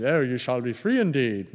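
a hint of a plummy English accent on the verse; lightly lampoon a pompous English reader; mock English accent. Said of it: "somebody with an English accent".

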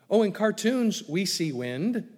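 usual speaking voice

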